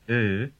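"Ö" as one sustained vowel; difficult vowel demo. Shown as a demonstration. Teacher says listen to it, isolated held "Ö". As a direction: model "Ö" alone, held for the ear. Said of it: "is a difficult one".

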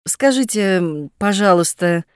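usual speaking voice

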